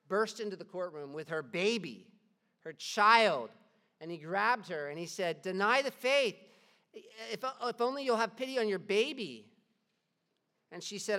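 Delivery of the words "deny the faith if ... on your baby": loud, higher frantic begging; loud desperate plea. Acted. Father bursting in, begging his daughter loudly. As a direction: plead with everything you have.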